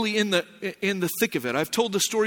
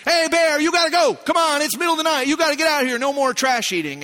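noisy shooing; 7 semitones higher than usual. Retold or imitated. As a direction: shoo it off with raised, higher voice. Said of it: "we just gotta make a lot of noise".

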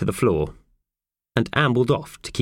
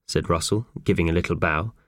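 usual speaking voice

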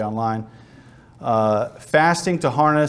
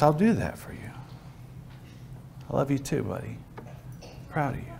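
very soft, unhurried fatherly hush; hushed, tender God; 11 dB quieter than usual. Acted. God listens to his praying son, hushed and tender. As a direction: speak softly, with gaps, to a beloved child.

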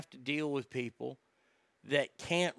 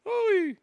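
hooey; 4 dB louder than usual. Acted. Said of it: "exclamation after impression".

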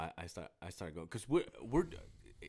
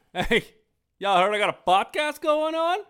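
high, loud showy announcement; hammed-up brag to the whole room; mock bragging shout; 16 dB louder than usual. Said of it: "I didn't say anything like".

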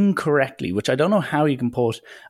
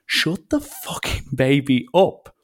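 annoyed passenger; 3 semitones higher, slower than usual. Retold or imitated. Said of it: "looking at the parents being like".

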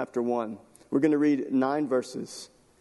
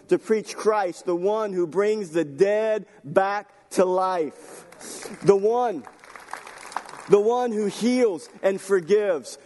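higher, forceful sermon delivery; impassioned preaching; 5 semitones higher than usual. Real, not acted. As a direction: drive each phrase home to the congregation.

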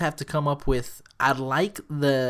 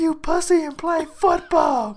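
mock coach yell; 6 dB louder than usual. Coach telling the hurt player to get up, mock scolding. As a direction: chew him out like an old coach.

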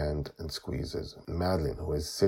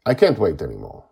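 brisk, louder, impatient; sharp; 8 dB louder, faster than usual. A narrator's reading. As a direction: cut the delay short firmly. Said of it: "she says sharply".

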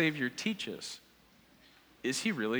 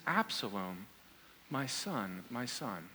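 low, soft, understated lament; grieving father; 6 semitones lower, 10 dB quieter, slower than usual. Retold or imitated. Preacher voices David over his son Absalom, subdued grief. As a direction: quietly imitate a father mourning his son. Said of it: "David is crying over his son".

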